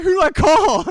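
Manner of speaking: very high, loud panicked question; mock panic. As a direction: panic about who to call. Freaking out at home.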